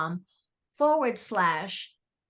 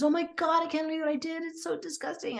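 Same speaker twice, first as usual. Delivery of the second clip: imitating a distraught client, pitched much higher; upset client; 8 semitones higher, faster than usual. Client in a panic over what she did, high mock distress. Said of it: "She goes".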